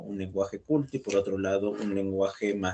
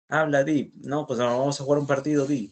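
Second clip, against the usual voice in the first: northern Peruvian di tag; 5 semitones higher, 4 dB louder than usual. Retold or imitated. Imitating northern speakers' di.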